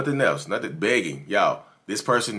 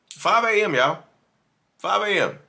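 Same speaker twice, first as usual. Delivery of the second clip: higher, incredulous; can you believe this hour; shocked disbelief; 11 semitones higher than usual. Real, not acted. Creator reacting to texts at 5 AM, incredulous.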